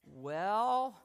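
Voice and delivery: a long, weighty "Well" in a light take on the professor; slow, ominous "Well" as he sizes you up; drawn-out Haddon "well". Retold or imitated. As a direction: stretch the "Well" out, heavy with judgment. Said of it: "and he'd go like".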